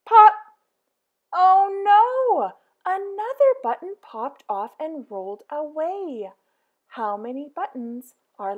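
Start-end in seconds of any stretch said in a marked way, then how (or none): none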